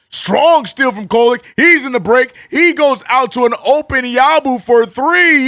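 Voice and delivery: high, loud broadcast-style call; live play-by-play. Acted. Streamer calling the Knicks comeback for chat, high and loud. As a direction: narrate each possession like a TV announcer.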